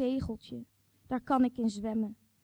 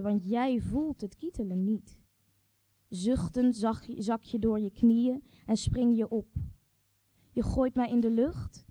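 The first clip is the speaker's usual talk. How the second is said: ordinary delivery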